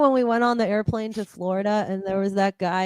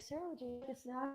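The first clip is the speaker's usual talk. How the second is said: much softer, higher put-on polite voice; stewardess; 4 semitones higher, 24 dB quieter than usual. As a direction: hush the polite offer like a stewardess. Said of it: "the stewardess was like".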